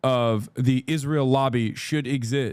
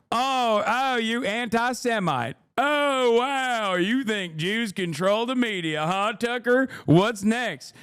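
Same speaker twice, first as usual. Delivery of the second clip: mock senator; 5 semitones higher than usual. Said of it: "He hit that so hard".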